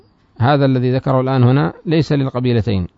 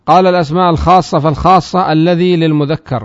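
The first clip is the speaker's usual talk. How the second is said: raised, louder recitation of the source text; reading the text aloud; 4 semitones higher, 5 dB louder than usual. Shown as a demonstration. Teacher reciting the grammar text to students, raised voice. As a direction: recite the matn line for the class.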